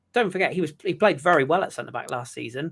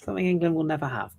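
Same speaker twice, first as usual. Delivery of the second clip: mock tempting; 4 semitones higher than usual. Luring Carl to the French team.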